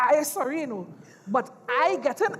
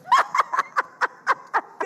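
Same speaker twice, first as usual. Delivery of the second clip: high taunting cackle; shrill mocking cackle; mocking laugh; 3 dB louder than usual. Mocking laugh at Mama Glow.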